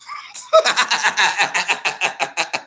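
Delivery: short amused laugh. Real, not acted. Streamer laughing at boxers beefing, amused laugh.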